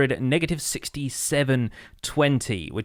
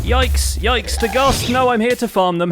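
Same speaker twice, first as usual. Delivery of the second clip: startled panic; 9 semitones higher, 5 dB louder than usual. Real, not acted. Ghast attacks in the nether.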